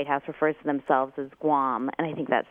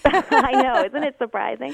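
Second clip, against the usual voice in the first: high-pitched, laughing agreement; amused, ironic and high; laughing mock surprise; 9 semitones higher, 4 dB louder than usual. Real, not acted. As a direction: play along with the joke through laughter. Laughs along with the sarcasm.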